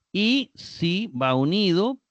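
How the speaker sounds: normal talking voice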